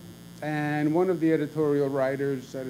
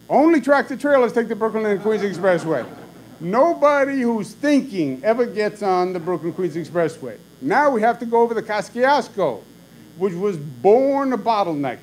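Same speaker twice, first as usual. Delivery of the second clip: higher, louder retold scolding; imitating an angry tirade; 8 semitones higher, 6 dB louder than usual. Father ranting at son over the BQE, mock angry. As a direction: hammer the contempt for the expressway. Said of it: "would really lose his temper".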